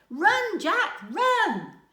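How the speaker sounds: high, loud cheering cry; excited shout. A narrator's reading. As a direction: yell it like a thrilled child. Child cheering story hero.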